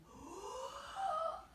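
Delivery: high audible inhale; big showy gasp filling the tummy; teacher modelling a breath in; demo breath in. Shown as a demonstration. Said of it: "you fill up that tummy".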